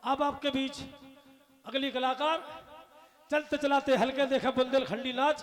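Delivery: loud, measured stage announcement; stage announcer. Acted. Compere introducing the next performer to the audience. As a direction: announce the next act to the crowd.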